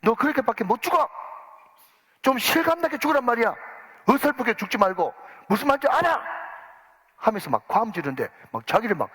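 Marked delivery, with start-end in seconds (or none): 0.0-6.2 furious yelling director, pitched up high; screaming film director scolding a bit-part actor. Retold or imitated. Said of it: "잡아먹을 듯이 고함을 지르면서".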